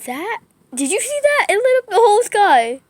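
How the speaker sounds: high, very loud amazement; real burst of thrilled disbelief; excited shout. Real, not acted. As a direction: shout in awe at the lightning. Lightning lights up the sky.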